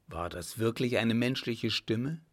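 ordinary delivery, nothing marked

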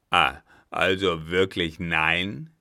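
loud, incredulous challenge; indignant captain. Acted. Captain rounds on the German, loudly incredulous. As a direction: flare up at the refusal.

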